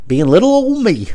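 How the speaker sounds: high, loud sing-song little voice; playful put-on tiny-me voice; cutesy self-mockery. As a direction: say it in a silly cute squeak. Jokey self-description.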